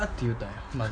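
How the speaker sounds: ordinary talk, nothing marked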